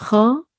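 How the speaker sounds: Cho with a throaty "kh" and long "o"; Hebrew syllable demo. Shown as a demonstration. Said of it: "Chet with a Cholem".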